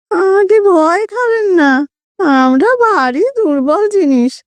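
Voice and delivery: high nasal ghostly voice. A narrator's reading. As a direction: play a timid ghost speaking through the nose. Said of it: "খোনা স্বরে".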